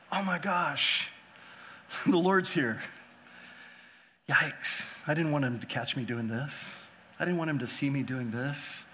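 slow startled gasp with a nervous laugh; a mock-startled believer caught out; caught off guard. A believer surprised by the Lord's return, mock startled and sheepish. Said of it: "the effect of the surprise".

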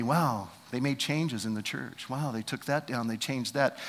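quiet church gossip; gossiping mutter. Retold or imitated. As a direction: grumble behind their backs. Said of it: "We say".

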